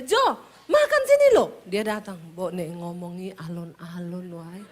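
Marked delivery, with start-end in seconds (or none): 0.0-1.6 high, loud shouted call across the house; loud Sulawesi call. Retold or imitated. Wife calling her husband to come, high loud shout. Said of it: "Setiap kali saya manggil dia". 2.3-4.6 lower, softer gentle Javanese plea; soft Solo husband. Retold or imitated. Husband asking his wife to speak gently, soft low Javanese. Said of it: "Orang Solo kalau ngomong nadanya turun terus".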